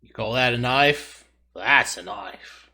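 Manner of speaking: slow, drawn-out mock Australian accent, Crocodile Dundee style; hammed-up Australian accent, savouring each word. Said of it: "My flawless Australian accent".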